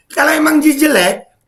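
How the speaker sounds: high, strained furious yelling; ranting at the screen, shouting high; angry shouting. Real, not acted. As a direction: yell it out, fed up and furious. Ranting furious at stadium critics.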